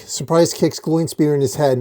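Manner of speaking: plain reading voice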